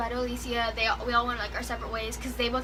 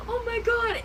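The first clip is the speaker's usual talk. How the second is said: starstruck squeal; 11 semitones higher, 4 dB louder than usual. Retold or imitated. Starstruck at the crew.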